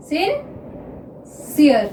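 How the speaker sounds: Officer drawn out very slowly; teacher dictating officer to students; slow dictation. Shown as a demonstration. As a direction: stretch officer as if writing it down. Dictating while writing shorthand.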